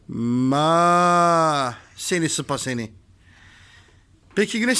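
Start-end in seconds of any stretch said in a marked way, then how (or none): 0.1-1.7 long held mock moo; cow moo imitation. Shown as a demonstration. Answering the cow question for little Güneş, imitated moo. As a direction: moo like a cow, drawn right out. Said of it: "Peki inek nasıl bağırıyor inek?".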